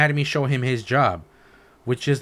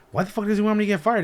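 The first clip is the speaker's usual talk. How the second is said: shocked disbelief; 9 semitones higher, faster than usual. Retold or imitated. Retold shock at coworker.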